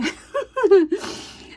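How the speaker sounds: high-pitched demonstrated laughing fit; hysterical laugh. Shown as a demonstration. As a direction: show off how you two laugh hysterically. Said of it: "how we do when we're laughing hysterically".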